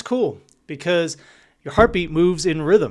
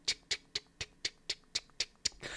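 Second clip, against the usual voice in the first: voiceless, much softer tick tick of a coaster chain; breathy rhythmic clicking of a coaster chain; 15 dB quieter than usual. Shown as a demonstration. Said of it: "you hear that chain".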